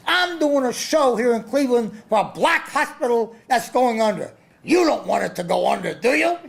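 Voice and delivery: hammed-up promoter yell with a laugh at the end; imitating Don King yelling. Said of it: "he's screaming at everyone there".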